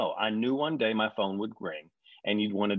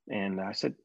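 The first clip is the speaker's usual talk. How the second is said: ordinary talk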